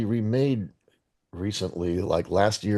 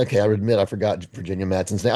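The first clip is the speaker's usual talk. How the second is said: ordinary talk, nothing marked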